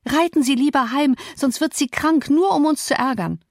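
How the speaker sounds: high-pitched call to someone outside. A narrator's reading. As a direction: call your advice out to him. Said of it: "rief ich hinaus".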